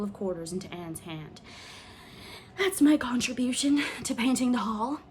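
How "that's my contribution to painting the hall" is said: breathless. A narrator's reading. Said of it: "she gasped".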